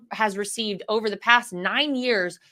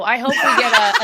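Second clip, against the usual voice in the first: laughing through the words, louder; 7 dB louder, faster than usual. Real, not acted. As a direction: giggle as you hope for it. Laughs imagining the accent.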